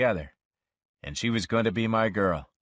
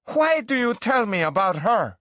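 put-on high, louder girl's voice, accusing; jealous demand; 12 semitones higher, 4 dB louder than usual. Girlfriend demanding why he mentions Dory, higher and louder. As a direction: she wants to know why, sharp and high.

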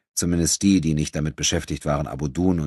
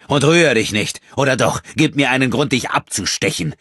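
loud, higher menacing threat; gruff threat; 9 semitones higher, 7 dB louder than usual. Acted. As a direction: threaten him, loud and harsh. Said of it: "sagte Enrico barsch". (barsche, laute Drohung)

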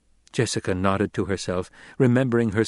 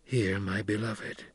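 much softer and slow; tender smile; 9 dB quieter, slower than usual. A narrator's reading. Paul gently asking his mother to heed Chani, soft and slow. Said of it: "A rare smile, warm and full of understanding".

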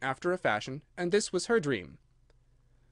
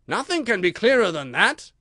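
high, loud, confident king; exultant royal certainty; triumphant; 6 semitones higher, 7 dB louder than usual. A narrator's reading. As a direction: rest the case with triumph. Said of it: "said the king triumphantly".

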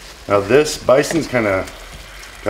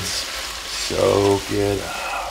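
drawn out; 2 semitones lower, 4 dB quieter, much slower than usual. Real, not acted. Drawn-out cooking phrase.

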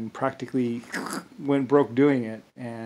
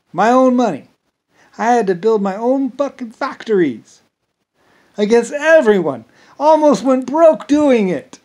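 much higher and louder, building outrage; indignant rant; 10 semitones higher, 10 dB louder than usual. Real, not acted. Complaining to viewers about funding it alone, indignant shout. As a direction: vent the injustice hard.

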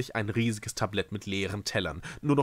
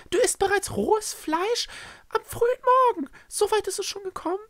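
very high, quick character voice; high-pitched scandalised questioning; appalled Echo; 5 dB louder than usual. Acted. Echo reproaches Schreckse for raw meat, appalled.

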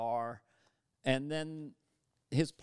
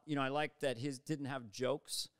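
ordinary talk, nothing marked

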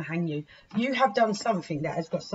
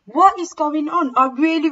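furious cry of frustration; angry outburst; 10 semitones higher, 10 dB louder than usual. Real, not acted. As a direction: demand it of the universe, fed up. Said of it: "really starting to fucking piss me off".

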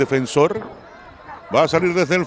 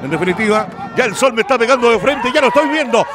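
ordinary talk

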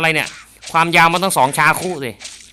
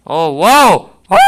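louder, higher whoa; startled cry; 4 semitones higher, 7 dB louder, slower than usual. Real, not acted. Gamer startled by a kicking zombie, loud whoa. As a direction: gasp it out in alarm.

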